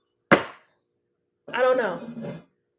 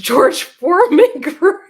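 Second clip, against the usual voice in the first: higher, louder, breaking into laughter; laughing hard; 7 semitones higher, 10 dB louder, slower than usual. Real, not acted. Cracking up at a friend's George Foreman grill story, laughing hard. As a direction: squeal the words through real laughter. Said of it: "I'm laughing so hard".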